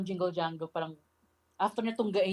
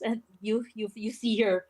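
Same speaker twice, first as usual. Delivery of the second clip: ordinary delivery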